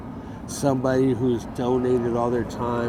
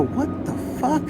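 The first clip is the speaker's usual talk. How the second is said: high, stunned retold exclamation; shocked disbelief; 10 semitones higher, 4 dB quieter than usual. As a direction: react like you can't believe it. Retold reaction in court.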